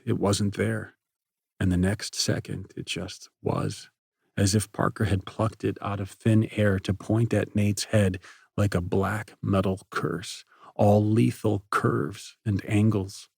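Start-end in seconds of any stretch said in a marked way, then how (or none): none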